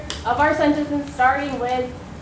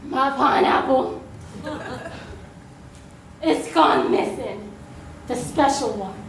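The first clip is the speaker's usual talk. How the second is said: film noir dame; 5 semitones lower than usual. Acted. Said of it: "You're gonna do it in film noir style".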